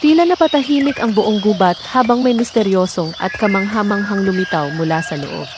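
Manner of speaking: ordinary delivery, nothing marked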